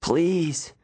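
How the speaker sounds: high, croaked begging; croaked plea. A narrator's reading. Hurt boy begging for help, croaked. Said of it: "I croaked".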